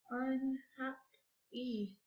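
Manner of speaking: "Unhappy" slow and soft in three pieces; counted syllables. Shown as a demonstration. Teacher counting syllables for pupils, slowly split. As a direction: count quietly through "Unhappy": un, hap, py. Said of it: "Let's clap it out".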